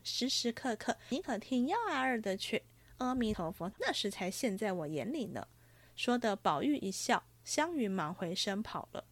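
lively storybook reading with voiced characters; story narration. A narrator's reading. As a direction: read the chapter like a storyteller. Reads novel chapter aloud, higher.

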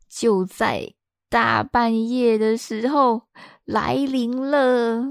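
very slow, drawn-out suspense; dramatic slow build. Acted. Vlogger teasing the long-awaited moment, slow and suspenseful. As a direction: stretch it out like a drumroll.

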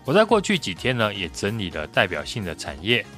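ordinary delivery

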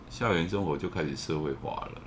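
ordinary talk, nothing marked

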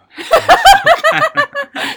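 laughing out high and loud, rising. Real, not acted. Mocking the cheater with a friend, a loud rising laugh. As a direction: let a loud laugh climb up.

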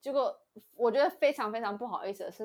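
normal talking voice

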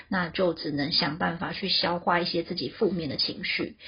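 ordinary talk

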